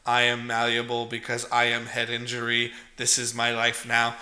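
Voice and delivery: deadpan like an empty automaton; mock automaton. Head-injured boy as automaton, mock robotic.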